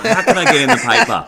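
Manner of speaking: high, loud teasing impression of a friend; mock scheming friend. Host teases guest about publicity-loving friend, mock scheming. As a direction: laughingly voice his publicity-hungry thought. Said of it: "He thought".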